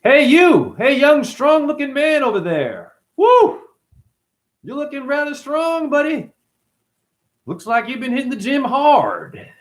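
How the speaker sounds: flirty skit voice. Acted. Skit for mom and priest, high camp flirting. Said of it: "So here's the skit".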